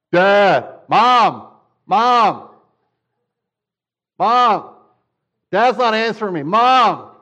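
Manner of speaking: loud, high whiny calls for Mom; a kid turning to Mom when Dad ignores him; nagging child. Acted. Child calling Mom from the back seat, loud whine.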